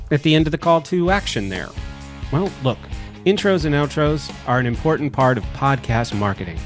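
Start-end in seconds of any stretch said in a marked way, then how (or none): none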